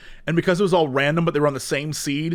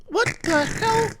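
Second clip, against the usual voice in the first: much higher, drawn-out confused cry; baffled; much slower than usual. Retold or imitated. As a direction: replay waking up to chaos, bewildered. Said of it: "and I was like".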